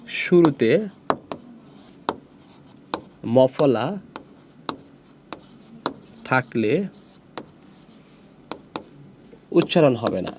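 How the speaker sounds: long pauses after each word or phrase; word-by-word dictation. Shown as a demonstration. Teacher giving students the rule to write, word-by-word dictation. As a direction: dictate it slowly, waiting between words.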